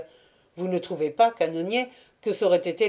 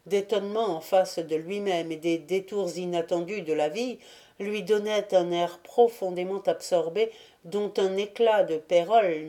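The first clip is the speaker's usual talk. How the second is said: ordinary delivery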